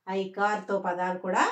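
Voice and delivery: usual speaking voice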